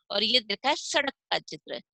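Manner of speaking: usual speaking voice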